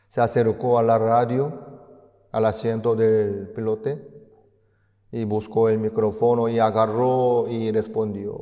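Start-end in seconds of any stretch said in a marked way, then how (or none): none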